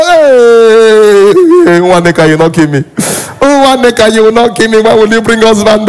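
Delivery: loud, drawn-out maternal wailing; hammed-up wail, stretching the ends into long cries; mock crying. Mother begging for grandchildren, mock wailing. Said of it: "Mama, why are you crying?".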